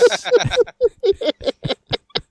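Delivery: high giggling laugh; burst of laughter. Real, not acted. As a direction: crack up laughing. Laughing at own attempt.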